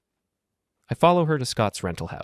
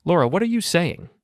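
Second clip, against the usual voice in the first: higher, louder, acted disbelief; feigned shock and hurt; 10 semitones higher, 4 dB louder than usual. Husband fakes hurt on the phone with his wife, high and shaken. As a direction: pretend the news floors you. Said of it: "I put shock and hurt into my voice".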